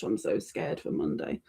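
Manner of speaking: hushed mock dread; scared whisper. Real, not acted. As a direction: whisper it like a nervous secret. Dreading next stream.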